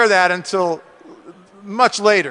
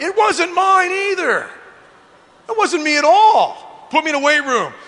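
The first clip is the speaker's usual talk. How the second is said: emphatic protest; 8 semitones higher than usual. Real, not acted. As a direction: stretch the words out high, insisting. Emphatic denial to imagined doubters.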